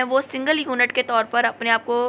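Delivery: ordinary delivery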